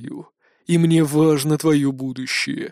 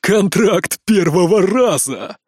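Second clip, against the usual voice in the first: торжествующе; 4 semitones higher, 5 dB louder than usual. A narrator's reading. Торжествующий отец-злодей.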